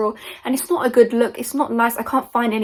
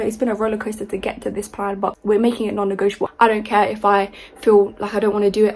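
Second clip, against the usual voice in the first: ordinary talk, nothing marked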